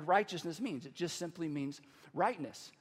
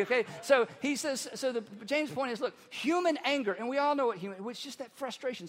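normal talking voice

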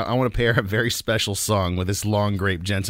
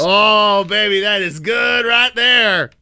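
excited; 9 semitones higher, 6 dB louder, much slower than usual. Real, not acted. Savoring the beer.

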